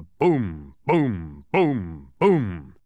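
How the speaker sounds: loud, falling drumbeat booms; resounding "boom" on each beat; booming drum imitation. A narrator's reading. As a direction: imitate a bass drum with evenly spaced booms. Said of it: "threw it into the air".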